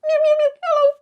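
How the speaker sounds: very high, squeaky cat voice; Pete the cat. Pretend. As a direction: meow then squeak a greeting, way up high. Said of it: "Pete said hello back to you".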